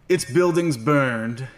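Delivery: slow, grand narrator read; dramatic narration. Acted. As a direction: intone it like a movie trailer. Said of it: "I'm gonna keep it going".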